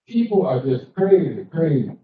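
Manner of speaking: ordinary talk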